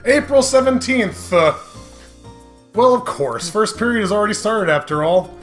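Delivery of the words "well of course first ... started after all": ordinary delivery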